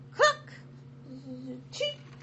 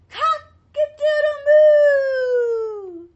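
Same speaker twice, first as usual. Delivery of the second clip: very high, loud, slow sung crow; a cow belting it out; stretched-out mooing crow; cow's crow; 12 semitones higher, 17 dB louder, much slower than usual. Acted. Cow crows.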